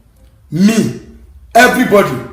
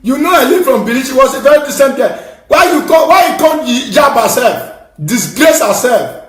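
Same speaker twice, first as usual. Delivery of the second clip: outraged shout; 7 semitones higher, 4 dB louder than usual. Real, not acted. Outraged rant about a woman.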